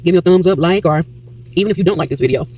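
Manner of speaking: usual speaking voice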